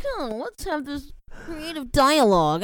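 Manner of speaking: mocking impression of co-host. Imitating the co-host's texts back at him, mock-pensive. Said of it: "Yeah, you're like".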